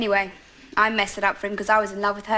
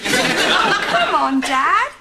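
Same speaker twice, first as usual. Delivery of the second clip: exasperated plea; 6 semitones higher, 5 dB louder, much slower than usual. Acted. Pleading to get door answered.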